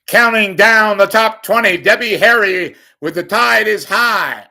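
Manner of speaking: loud, high countdown announcer; radio DJ. Pretend. Host mocking a radio countdown after singing, loud and high. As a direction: hype it like a top twenty DJ. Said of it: "mock radio countdown".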